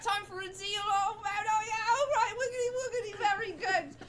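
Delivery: put-on very high, loud giddy street kid; gleeful urchin deal. Orphan spits in his hand to seal a deal, high and giddy. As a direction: squeal the deal like an excited orphan. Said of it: "And he's like".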